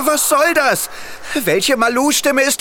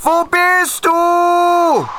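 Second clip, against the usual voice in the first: long high call into the woods; 8 semitones higher, much slower than usual. Acted. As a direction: call far across the forest. Calling for lost friend Malu. (laut rufend)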